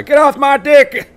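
slow, high shouted battle cry. Retold or imitated. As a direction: bellow it like a war cry. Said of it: "that's our battle cry".

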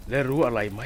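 usual speaking voice

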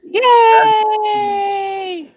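high, loud joyful yay; excited cheer. Real, not acted. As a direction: shout it with glee. Cheers as the item is sold.

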